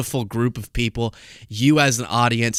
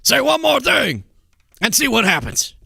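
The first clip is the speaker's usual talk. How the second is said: strained, throaty dare, higher; growled threat; 10 semitones higher than usual. Retold or imitated. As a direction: rasp it like a tough guy. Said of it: "I wish I could speak like that more".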